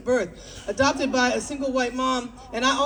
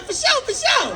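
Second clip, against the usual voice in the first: mock cool repeat, pitched way up; trying to sound cool; 11 semitones higher than usual. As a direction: overdo the slang in a high squeal. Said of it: "trying to get the moment back".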